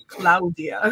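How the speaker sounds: "Claudia" in a lightly done Italian accent. Shown as a demonstration. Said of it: "say it with my Italian accent".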